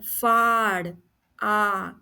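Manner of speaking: फाड़ with a stretched "aa", then आ alone; sounding out आ. Shown as a demonstration. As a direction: hold the open vowel in फाड़. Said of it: "आ का sound आ रहा है".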